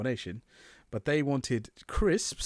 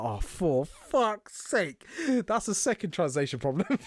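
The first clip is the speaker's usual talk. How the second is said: exasperated; 6 semitones higher, slower than usual. Retold or imitated.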